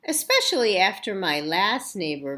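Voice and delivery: ordinary delivery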